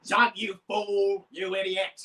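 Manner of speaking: grouchy, drawn-out insult; Zoro. Shown as a demonstration. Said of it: "a really grouchy, loud voice".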